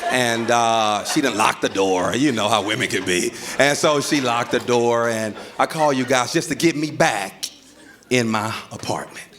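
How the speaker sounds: composed, polite, carefully spoken mock formality; police voice. Husband explaining to arriving police, put-on composed police voice. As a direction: talk like a reasonable citizen addressing officers. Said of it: "I put on my police voice".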